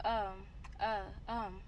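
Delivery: halting um and uh; stuttering. Retold or imitated. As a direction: stumble over the fillers. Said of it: "like, just stuttering".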